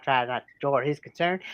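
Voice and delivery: ordinary delivery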